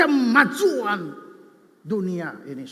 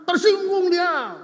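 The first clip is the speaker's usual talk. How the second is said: mock offended outburst; 7 semitones higher, slower than usual. Preacher mocks touchy churchgoers.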